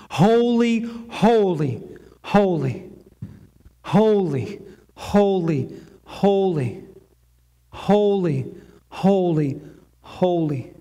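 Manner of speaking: a worshipful repeated chant fading out; holy nine times. Shown as a demonstration. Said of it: "possibly this is actually uttered nine times".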